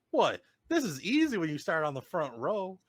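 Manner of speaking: high, loud imitation of a gleeful driver; mock incredulous boast. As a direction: exclaim it like a winner joking about his win. Retelling winner's cocky joking reply.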